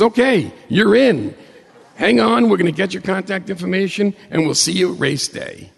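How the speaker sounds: mock radio host laughing happily. Radio host welcoming him on air, laughing.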